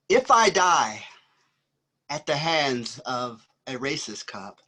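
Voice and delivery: rhythmic spoken-word performance, swelling and falling; poetry recital. Acted. Poet performing his verse to a live audience, rhythmic spoken word. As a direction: perform the poem like a jazz solo, riding the beat. Begins reading second poem.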